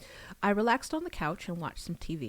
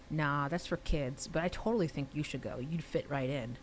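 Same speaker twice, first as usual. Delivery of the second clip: ordinary delivery, nothing marked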